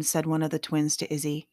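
usual speaking voice

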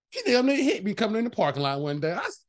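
ordinary talk, nothing marked

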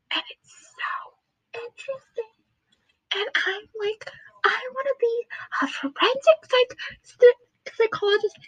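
high, hushed squeal, growing firmer; excited whispering. Real, not acted. Psychology fan confiding her dream career, excited whispering. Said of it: "I don't know why I'm whispering".

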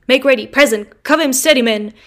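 loud barked military commands; shouted orders. Retold or imitated. Convict imitating an officer commanding soldiers, loud.

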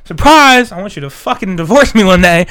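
mock surprise. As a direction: announce it like a gleeful trick. Mock proposal.

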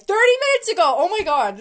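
high, fast and loud; excited disbelief. Real, not acted. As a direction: shout it in delighted shock.

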